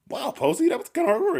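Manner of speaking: very high, airy imitation; ditzy airy voice. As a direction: put on a classic ditzy voice. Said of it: "airy voice".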